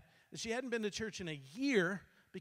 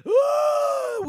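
high, loud ecstatic "ooh"; loud ecstatic cry; 14 dB louder than usual. Retold or imitated. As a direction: cry out as the Spirit hits. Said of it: "she does".

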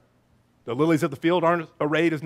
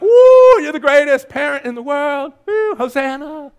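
high, loud excited whooping; cheering kids; 9 semitones higher, 11 dB louder, slower than usual. Acted. Kids chanting for the greatest parent, high loud cheer. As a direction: cheer like thrilled kids in the back seat. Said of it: "hear the kids begin to chant your name".

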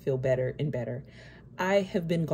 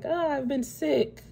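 much higher, airy mock-cheerful voice, laughing at the end; breezy brush-off; 9 semitones higher than usual. Mocking her old video self, lightly dismissive and cheerful. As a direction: imitate yourself glossing over it with fake cheer. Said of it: "I was kind of like".

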